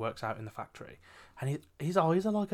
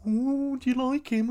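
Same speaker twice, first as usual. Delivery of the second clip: put-on high, teasing girly squeal; 14 semitones higher, 7 dB louder than usual. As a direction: tease like an excited office gossip. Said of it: "she was like".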